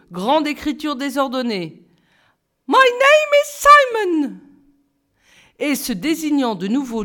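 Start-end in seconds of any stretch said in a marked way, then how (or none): 2.7-4.4 exclaiming English teacher. A narrator's reading. English teacher Simon introducing himself to the class, very high and loud. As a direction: announce yourself like a grinning showman.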